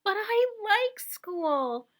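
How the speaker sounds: put-on high, slow little-girl voice; slow, high girl's plea; little girl pleading. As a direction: stretch it like a small child. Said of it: "Cassandra said".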